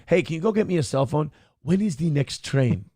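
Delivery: quick, mock African accent meant as an Indian one; a lightly done African accent put on a would-be Indian; African accent used for Indian. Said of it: "I did my African voice also for Indian".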